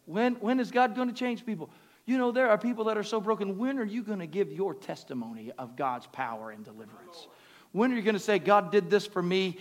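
loud, rising preacher's call; booming motivational speech. Acted. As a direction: build it up and push the words out. Preaching at full force.

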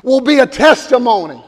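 loud, slow, fervent sermon; preacher driving it home to the congregation; preaching at full force. Acted. Pastor urging the church, loud slow preaching.

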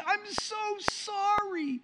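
high, drawn-out mock apology; apologetic wife. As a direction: imitate a mortified wife, slowly and high-pitched. Apologetic wife voiced in story.

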